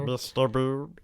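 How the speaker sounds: Rickman-style drawl. Retold or imitated. Rickman voice game.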